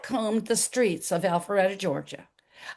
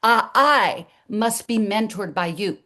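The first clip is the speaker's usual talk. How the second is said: firm, emphatic insistence in usual pitch; assertive demand. Acted. Telling the doctor she must mentor her, firmly. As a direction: state it boldly, no asking. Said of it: "Oh, no, no, no. I went in".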